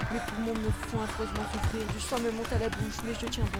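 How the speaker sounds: narration tendue, voix plus haute; récit haletant de fuite. A narrator's reading. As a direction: raconter une course éperdue, dans l'urgence. Récit de la course éperdue. (récit d'action sous tension, ton élevé)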